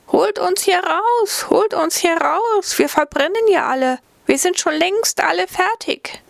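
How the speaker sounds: desperate trapped breads. Acted. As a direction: wail for rescue like tiny voices trapped in the heat. Breads crying from the oven.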